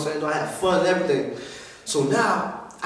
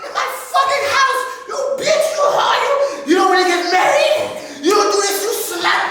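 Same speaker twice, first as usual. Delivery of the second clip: very high shrieking mom, furious and shouting; mother going off. Retold or imitated. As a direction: shriek at him like his outraged mother. Mom voiced catching son.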